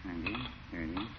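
softly counting coins while claiming to listen; absent-minded count. Acted. Druggist counting change, pretending to listen to Gildersleeve. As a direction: mumble the numbers, mind elsewhere.

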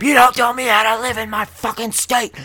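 high, strained angry scream; screaming back. Retold or imitated. Driver screams back at old man half a block away, furious. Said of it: "And I scream back at him".

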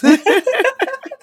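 bright high laugh. Real, not acted. Laughing after the toast.

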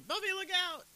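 high, loud cry of warning; mock scream. Retelling Giles warning Buffy of danger behind her, mock scream. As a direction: yell an urgent warning, imitated. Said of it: "Giles is screaming".

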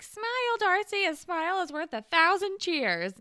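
high, loud cheerleader chirp; mock peppy cheer. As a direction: act out an over-bright pep-squad call. Teammate's pep line acted out.

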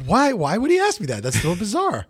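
high-pitched, incredulous questioning; acted exasperated puzzlement; bewildered. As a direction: fret over it, totally mystified. Acting out bewildered agonizing.

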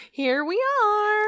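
high, slow, put-on resignation; squeaky resigned. Real, not acted. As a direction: draw it out in a squeak. Resigned wrap-up of story.

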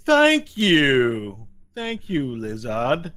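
slow giant voice, loud then softening; big simple monster giving thanks; grateful Cyclops. Acted. Cyclops thanking the lizard, grateful. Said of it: "he goes".

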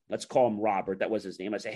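ordinary talk

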